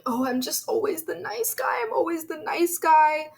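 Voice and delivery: high whiny mock-sob of a self-pitying guy; imitating him whimpering about being the nice guy; mocking crying man. Mocking the crying boyfriend on the show, whiny and high. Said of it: "He starts going".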